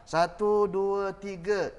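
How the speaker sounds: slow, loud count of satu, dua, tiga; slow counted beats. Shown as a demonstration. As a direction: drag each beat of dua, tiga. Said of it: "Ada berapa ketuk?". (kiraan perlahan dan kuat)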